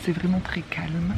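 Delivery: slow hushed whisper. Real, not acted. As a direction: whisper slowly to keep it calm. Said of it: "J'essaie de pas parler trop fort". (chuchoté lentement)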